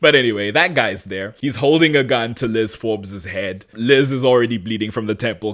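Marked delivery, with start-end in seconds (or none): none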